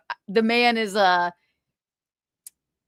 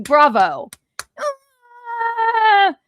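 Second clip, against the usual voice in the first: ordinary delivery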